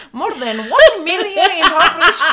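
put-on high, loud Irish accent breaking into laughter; giggling through a fake Irish accent; laughing Irish accent. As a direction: read it in a mock Irish brogue and crack up. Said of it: "Trying to lose this accent while I read on".

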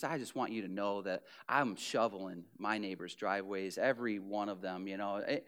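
low, soft, false-humble mumble; humble-brag. Retold or imitated. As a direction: mumble a boast as if it's nothing. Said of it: "one of us would be like".

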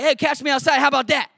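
high, loud mock punk challenge; hammed-up sassy dare; Danielle Brigoli. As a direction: imitate a defiant teen catchphrase, not quite cool. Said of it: "she says it more cool".

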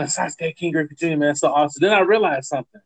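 ordinary talk

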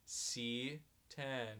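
flat, bored bingo call; monotone voice. Retold or imitated. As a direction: drone it like you hate the job. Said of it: "Monotone voice".

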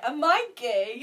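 loud, theatrical drawn-out question; hammed-up musical-theatre cry; mock dramatic quote. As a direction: belt it out in mock crisis. Quoting the show's song.

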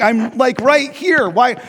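high, indignant plea; eager pleading. Retold or imitated. Ignored tour guest wanting to be picked, eager and indignant. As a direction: plead to be noticed.

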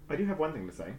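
normal talking voice

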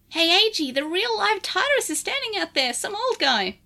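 lightly voiced Digimon sidekick; Lugumon voice. Lugumon character voice.

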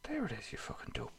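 hushed aside, whispered. Real, not acted. Muttering to her sister off mic, whispered insult. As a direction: whisper the insult under your breath. Aside to co-host while recording.

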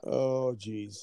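normal talking voice

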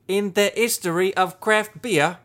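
put-on British accent wobbling toward Australian. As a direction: read it grandly in fake British. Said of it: "with a British accent".

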